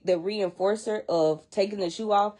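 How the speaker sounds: usual speaking voice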